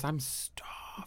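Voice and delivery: breathy whisper. Real, not acted. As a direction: whisper it to yourself. Tired reporter says it aside.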